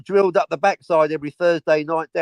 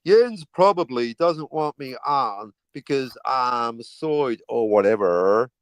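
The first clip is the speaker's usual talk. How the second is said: lower, slow, held-back accent; low slow read-out; 5 semitones lower, slower than usual. Shown as a demonstration. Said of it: "I won't do the accent".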